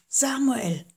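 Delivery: a hint of a distant, otherworldly voice calling; lightly voiced voice from afar; voiced mysterious call. A narrator's reading. The voice calls once more.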